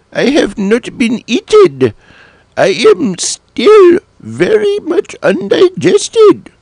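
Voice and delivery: high, squeaky cartoon cat, puzzled. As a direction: squeak it out like a small confused cat. Cat figure after being eaten.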